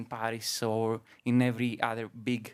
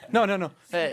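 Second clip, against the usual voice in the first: louder, laughing no no no; laughing denial; 6 semitones higher, 6 dB louder, faster than usual. Real, not acted. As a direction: laugh while correcting.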